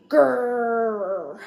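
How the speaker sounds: playful frustrated grrr with the class; group growl. Shown as a demonstration. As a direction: growl together on three. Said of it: "could all grr together".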